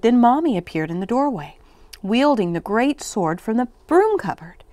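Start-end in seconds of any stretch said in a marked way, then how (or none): none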